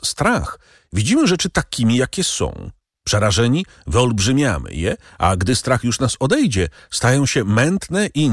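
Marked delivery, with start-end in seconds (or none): none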